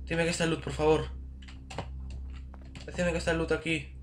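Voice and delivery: high-pitched hopeful begging; hopeful pleading. Real, not acted. Gamer begging for loot to be here, high pleading.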